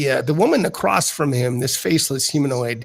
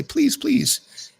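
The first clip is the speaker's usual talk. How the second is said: much higher, gently pleading; coaxing weaver; 11 semitones higher than usual. Acted. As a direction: beckon sweetly toward the portal. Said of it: "the Weavers direct you and say".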